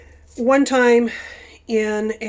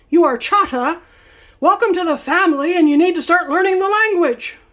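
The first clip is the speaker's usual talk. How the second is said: much higher, louder welcoming chief; imitating the chief; 8 semitones higher, 6 dB louder than usual. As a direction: boom out a hearty welcome.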